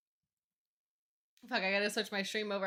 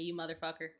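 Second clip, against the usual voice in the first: ordinary talk, nothing marked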